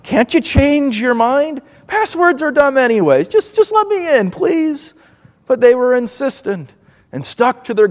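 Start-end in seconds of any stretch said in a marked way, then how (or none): 0.0-4.9 imitating a kid begging, higher and rising; mock-whiny plea to be let into the fort; childish pleading. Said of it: "and I pleaded".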